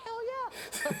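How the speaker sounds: high-pitched excited squeal; starstruck outburst. Acted. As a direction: burst out like a giddy fan. Comic admits being starstruck.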